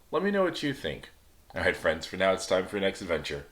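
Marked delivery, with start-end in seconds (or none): none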